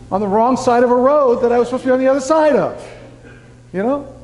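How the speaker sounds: high-pitched, rushed comic complaint; playfully indignant griping; mock exasperation. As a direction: rattle off the grievance, pitch way up. Retells phone sending him in circles.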